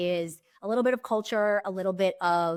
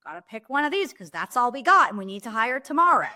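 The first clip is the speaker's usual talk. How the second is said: higher-pitched, mildly pressed hiring worry; mock pressured urgency; 4 semitones higher, 4 dB louder than usual. Hiring manager imagining too few applicants, mock pressured. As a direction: voice the squeeze of having no options. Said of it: "feeling the tightness of".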